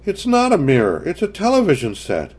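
put-on a child correcting her mother; child Kathy. A narrator's reading.

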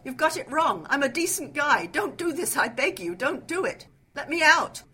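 loud, high, frantic pleading; desperate shouting. A narrator's reading. Man being walled in begging his captor, desperate shout. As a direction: beg at full voice for your life. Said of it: "he shouted".

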